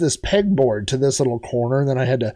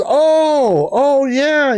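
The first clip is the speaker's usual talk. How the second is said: high, loud oh of realization; a friend suddenly catching on; surprised oh yeah, pitched up; 12 semitones higher, 4 dB louder, much slower than usual. Retold or imitated. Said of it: "And he goes".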